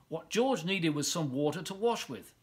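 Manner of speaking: ordinary delivery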